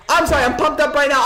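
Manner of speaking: excited near-yell about the upset; pumped up. Real, not acted. Basketball fan on stream, pumped up, near yelling. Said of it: "I'm screaming. I'm yelling. I'm getting loud".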